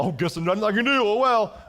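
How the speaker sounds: high, loud mock-apathetic sigh of giving up; hammed-up whiny resignation; apathetic shrug. Mocking an apathetic prisoner, high and whiny. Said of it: "not an apathetic person".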